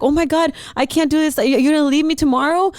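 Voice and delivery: panic attack. Retold or imitated. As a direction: beg your friend not to leave, frantic. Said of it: "I literally have a panic attack".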